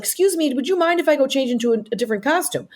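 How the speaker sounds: high, mock-polite request; pointedly polite. Performer asking rugby crowd in dressing room, high mock-polite. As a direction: ask with exaggerated politeness, pitched well up.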